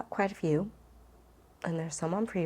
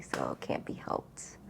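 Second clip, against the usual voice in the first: unvoiced joking whisper, trailing off; whispered aside; 10 dB quieter than usual. Real, not acted. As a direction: confide it like a guilty little secret. Self-mocking aside at the end.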